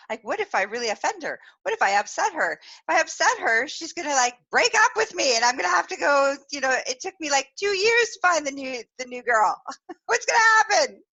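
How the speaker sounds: high, loud, spiralling worry; comic panic. Retold or imitated. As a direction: let mock anxiety climb into a laughing wail. Replays nervous worries about offending her.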